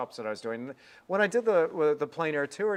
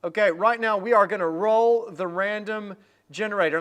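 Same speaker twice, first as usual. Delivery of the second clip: announcer hype; 5 semitones higher, 7 dB louder than usual. Real, not acted. Announcing the giveaway draw.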